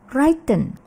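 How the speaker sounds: WRITTEN as RYE-ten; misread as write. Shown as a demonstration. Teacher shows another misreading, mispronounced. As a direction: put the vowel of write into WRITTEN. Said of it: "ada juga yang membaca".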